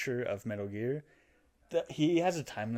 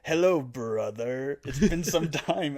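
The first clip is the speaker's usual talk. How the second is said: slow, raised, louder British villain voice; British accent; 4 semitones higher, 5 dB louder, slower than usual. Retold or imitated. Possessed Ocelot greets his brother Snake, in a British accent. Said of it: "starts speaking with a British accent".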